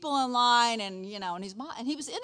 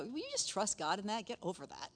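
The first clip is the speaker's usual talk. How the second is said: harsh dismissive; 8 dB quieter than usual. Shown as a demonstration. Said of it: "I don't need to be doing it that way".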